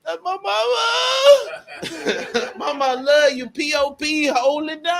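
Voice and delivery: choking up while calling out to mom; voice breaking. Real, not acted. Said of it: "I don't wanna cry on the show".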